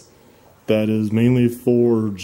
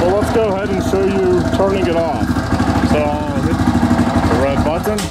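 shouting over engine; 7 semitones higher than usual. Real, not acted. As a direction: keep talking up over the engine. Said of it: "Hope you can hear me over the engine".

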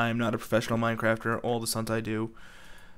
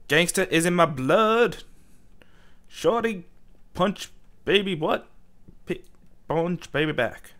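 higher, louder gangster boast; mock gangster; 5 semitones higher, 4 dB louder than usual. Gamer answering his own rebuke, mock gangster bravado. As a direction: play the hammed-up gangster, proud and loud. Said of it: "Don't try to be gangster".

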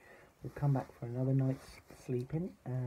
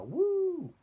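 high, loud ghost wail; swelling storybook ghost noise; louder ghostly woo; 8 dB louder than usual. A narrator's reading. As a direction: let the hoot ring out loud. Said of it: "there was a louder".

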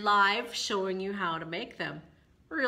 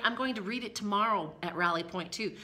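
plain reading voice

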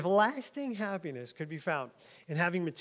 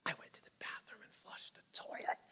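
hushed whisper of a big secret; 14 dB quieter than usual. Pretend. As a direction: whisper it like a scoop. Mock anchor's confiding aside.

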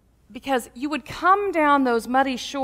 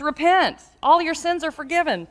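raised, higher preacher voice; preacher's proclamation; 6 semitones higher, 4 dB louder than usual. Retold or imitated. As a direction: lightly imitate a river preacher declaring it. Preacher proclaims while dunking him.

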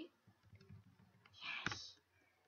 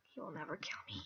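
whispered boast; 8 semitones lower, 9 dB quieter, faster than usual. Real, not acted.

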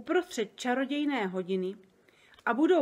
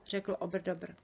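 ordinary delivery

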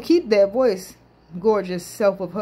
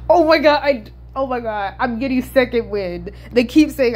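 ordinary talk

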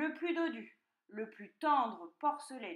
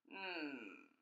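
low, soft pondering hum; fox mumbling; 6 semitones lower, 7 dB quieter than usual. A narrator's reading. Fox weighing piglet's request, low mumble. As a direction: hum low to yourself, considering. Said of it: "marmonna le renard".

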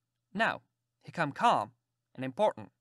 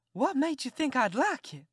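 high indignant demand; indignant aunt; 9 semitones higher than usual. Acted. Aunt demanding why he kissed her, high. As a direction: demand an answer from a fool.

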